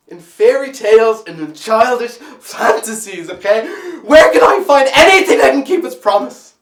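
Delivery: very loud, high-pitched bitter demand; anguished outcry. Acted. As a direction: cry out the questions, building to a shout. Desperate outcry in monologue.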